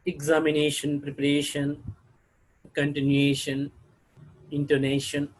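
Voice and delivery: "examination, preparation, continuation, intonation", each stressed on the "a" before "tion"; stress on penultimate syllable. Shown as a demonstration. Teacher listing I O N examples, "examination, preparation, continuation, intonation" stressed before the final "tion". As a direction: list "examination, preparation, continuation, intonation" word by word, weight on the second-last syllable. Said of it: "second syllable from the last".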